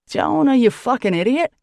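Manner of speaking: loud angry yell. Acted. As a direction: yell the insult. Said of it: "yells Sam".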